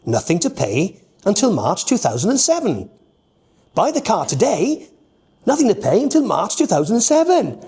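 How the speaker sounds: car advert read in the Nigel character voice. Shown as a demonstration. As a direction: sell the deal in the silly character voice. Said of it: "with this Nigel voice".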